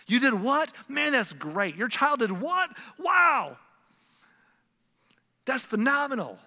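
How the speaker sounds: high, loud, thrilled astonishment; amazed delight. Acted. Celebrating a friend's and their child's success, loudly amazed. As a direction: react as if bowled over by the news. Models thrilled reaction to good news.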